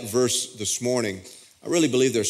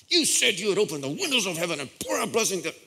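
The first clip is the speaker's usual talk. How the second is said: fast, high, pushy quote at God; mock demanding Malachi quote, higher and fast; 7 semitones higher, faster than usual. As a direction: rattle off the promise like a demand. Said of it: "I was quoting Malachi to God every week".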